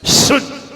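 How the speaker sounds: शुद्ध as a hard hiss with a cut-short ending; long whistling "sh" to open शुद्ध; whistling sibilant. Shown as a demonstration. Teacher demonstrates the correct word, whistling शुद्ध. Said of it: "शुद्ध कैसे बोला जाता है".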